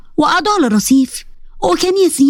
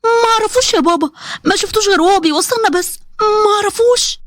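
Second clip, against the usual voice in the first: higher, pleading daughter; frightened pleading; 7 semitones higher than usual. Acted. Daughter swearing to her angry father that she did nothing, in a frightened, higher voice. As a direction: plead your innocence, voice pitched up in fear. Doaa denies knowing him.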